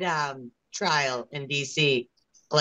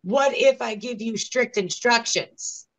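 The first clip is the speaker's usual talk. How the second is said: lightly mimicked official, pitched higher; mock the coaxing offer in a raised voice; imitated judge; 5 semitones higher, slower than usual. Judge to a doubtful juror, lightly mimicked coaxing. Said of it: "the prosecution and the judge say".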